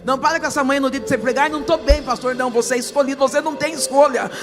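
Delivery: loud, high, fervent preaching, half sung; full preaching. Acted. Pastor preaching to the congregation, loud fervent preaching. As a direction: preach it full force to the church.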